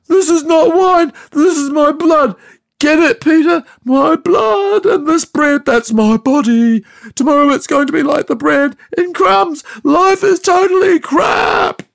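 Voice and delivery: high wailing shout, crying through the words; screaming and crying. Retold or imitated. Jesus at the Last Supper, drunk, wailing to Peter, screaming and crying. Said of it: "he started to scream and cry".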